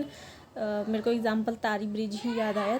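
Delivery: ordinary delivery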